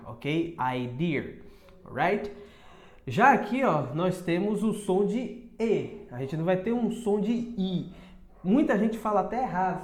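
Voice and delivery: ordinary talk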